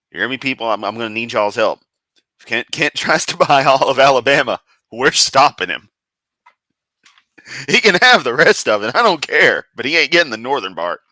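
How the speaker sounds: loud playful indignation; rally the crowd in fake fury; mock outrage. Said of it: "I just took his joke completely seriously".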